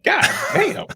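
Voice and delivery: shocked high yelp; stunned. Retold or imitated. Stunned reaction to a bold threat.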